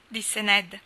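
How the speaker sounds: plain reading voice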